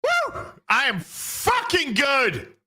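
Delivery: high, drawn-out whoop of triumph; elated self-congratulation. Real, not acted. Streamer delighted by his own impression, elated crowing. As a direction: crow about yourself, high and slow.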